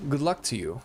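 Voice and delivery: ordinary talk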